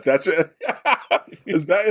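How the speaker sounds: high-pitched, laughing too hard to finish; real helpless giggling between the words; cracking up. Real, not acted. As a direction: try to ask through bursts of laughter. Cracking up at the impression.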